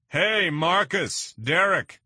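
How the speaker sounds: slow, very high, loud hail; calling out. A narrator's reading. Vargas calls the brothers from his boat, slow loud hail. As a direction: call their names across the water.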